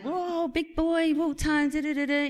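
high, drawn-out parental welcome; mock proud cheer. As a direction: make a big fuss of the boy. Parents greeting son home from school.

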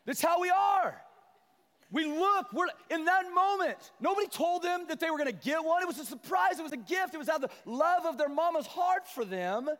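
high, loud passionate preaching; full-force preaching. Acted. Pastor preaches to the church at full force. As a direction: drive the point home to the church.

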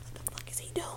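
whispering; hushed whisper; whispered aside. Retold or imitated. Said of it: "And he was like".

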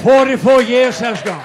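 loud, high preached proclamation; preaching shout. Real, not acted. Preacher shouting to the church about his years. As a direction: shout it out to the congregation.

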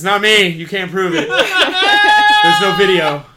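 loud laughing protest; laughing denial. Pretend. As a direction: deny it loudly while laughing.

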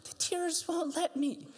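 put-on high, slow child's wail; weepy little boy refusing; whiny child. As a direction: whimper it like a crying boy. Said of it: "son's reply quoted".

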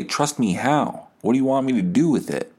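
ordinary talk

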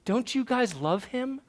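put-on high, soft, drawn-out little-girl voice; daughter's plea. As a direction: imitate a sensitive child's wounded question. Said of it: "she looks and she says".

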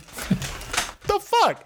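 shrill, very high "the fuck"; high squeak of disbelief. Retold or imitated. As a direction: squeak it out in shock. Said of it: "I'm like".

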